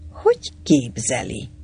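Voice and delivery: plain reading voice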